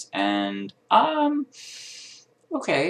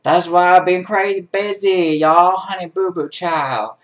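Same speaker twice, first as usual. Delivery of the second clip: high, loud hammed-up outburst; sassy catchphrase; 8 semitones higher, 8 dB louder than usual. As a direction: belt it out sassily, stretching the last words. Mock catchphrase outburst about moving.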